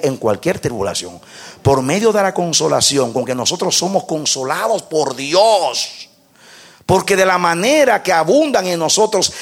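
usual speaking voice

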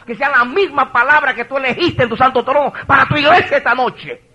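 high, loud, driving fervour; full-force preaching. Real, not acted. Pastor declaring he speaks only God's words, full-force preaching.

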